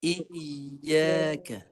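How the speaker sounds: "Iyyaka" yy held without stopping the voice; "Iyyaka" with a smooth unbroken yy; no stop. Shown as a demonstration. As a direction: keep the voice going through "Iyyaka". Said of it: "tukar dengan ya".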